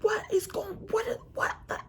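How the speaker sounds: high squeaky mock of a panicked man; mocking his panic. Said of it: "He like".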